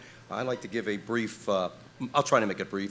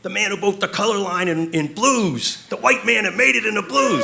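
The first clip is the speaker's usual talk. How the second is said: impressed [name]; 11 semitones higher, 6 dB louder than usual. Retold or imitated. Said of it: "he goes".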